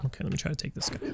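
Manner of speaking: breathy whisper to himself, quick; whispered aside. Real, not acted. Gamer whispering to himself mid-play, whispered. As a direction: mutter it under your breath while focused on the game. Muttering aside while playing.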